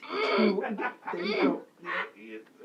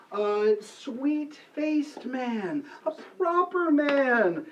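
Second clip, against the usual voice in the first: slow flattering; 5 semitones higher, much slower than usual. Acted. Flattering Bottom, drawn out.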